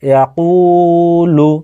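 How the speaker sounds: ইয়াকুলু with a long, held কু; drawn-out vowel. Shown as a demonstration. Teacher demonstrating madd, slow ইয়াকুলু with কু drawn out. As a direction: slowly stretch the কু in ইয়াকুলু. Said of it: "কফের সাথে অ মাদ্দা যুক্ত রয়েছে".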